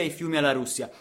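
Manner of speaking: ordinary talk, nothing marked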